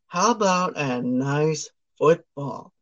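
slow, soft imitated offer; mock Santa suggestion. Santa suggests a gift to tongue-tied Ralphie, slow and soft mock voice. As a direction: draw it out softly, playing Santa.

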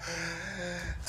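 short high laugh. Real, not acted. Laugh before answer.

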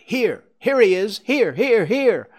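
high, loud repeated calls; urgent high shouting; shouted. A narrator's reading. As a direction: shout to summon someone from far off. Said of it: "he shouted".

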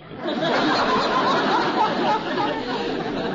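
loud, high rolling chuckle; hearty chuckle. Acted. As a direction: let a big chuckle roll out. Laughs at the boy's question.

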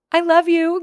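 high, loud gushing declaration; gushing I love you. Retold or imitated. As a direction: blurt it out with delight. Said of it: "I just was like".

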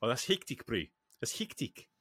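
South African mates' slang, lightly imitated; a mild South African twang on hectic; South African accent. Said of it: "who always like to say".

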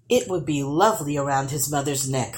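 ordinary delivery

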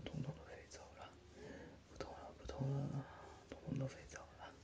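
hushed soothing whisper; big brother whispering comfort to his hurt sister; ASMR whisper. Acted. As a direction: whisper the pain away to a little sister.

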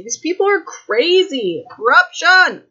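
rising to an outraged high yelp; a real burst of outrage at the plot; worked up. Real, not acted. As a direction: builds from disbelief to a loud high cry. Reacting to the death threats.

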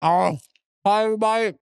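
talking through a mouthful of bread; words round a bite of bread; mouth full. Real, not acted. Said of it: "I have bread in my mouth".

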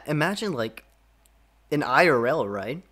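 ordinary talk, nothing marked

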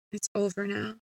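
very soft, spent statement; hushed and resigned; subdued finality. A narrator's reading. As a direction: say it quietly, letting it rest. Quiet closing words after ordeal.

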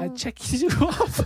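put-on high, slow German accent through laughter; mock German accent. Co-host as killer ticking off victims, laughing mock German accent.

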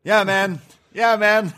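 high-pitched catchphrase impression; mock-enthusiastic high "yeah, man"; Tony Schiavone. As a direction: imitate his "man" habit, pitched right up. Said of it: "when Tony Schiavone calls you man".

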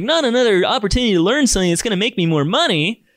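high, groaning mock of a reluctant student; hammed-up whine of a listener who hates courses; mock whiny complainer. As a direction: sneer it like someone sick of courses. Mocking people tired of courses.

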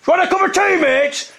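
high, loud shout; top of voice shout. Retold or imitated. Shouting in the builder's ear, a loud high yell. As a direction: bellow it at full volume. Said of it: "shouted at the top of my voice".

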